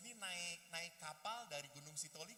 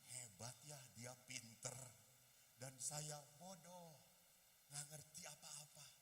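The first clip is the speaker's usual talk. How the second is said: low, soft, slowing musing; subdued self-talk; 6 semitones lower, 7 dB quieter, slower than usual. Retold or imitated. Pupil admiring the math teacher, subdued self-talk. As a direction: think aloud humbly, low and slow.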